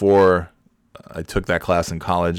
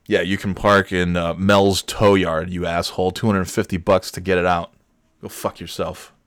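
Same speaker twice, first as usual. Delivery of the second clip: ordinary talk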